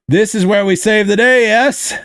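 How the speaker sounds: put-on Hammer's thick Russian accent. Hammer boasting to allies, in a thick Russian accent. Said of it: "in his thick Russian accent".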